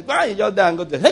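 normal talking voice

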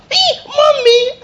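very high, loud, slow squeal for mommy; high drawn-out mock squeal; childish cry. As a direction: wail it like a frightened little girl. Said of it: "you are talking to her".

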